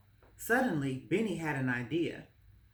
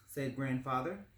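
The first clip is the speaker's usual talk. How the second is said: plain reading voice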